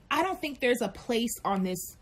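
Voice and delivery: ordinary delivery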